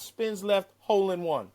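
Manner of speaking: ordinary talk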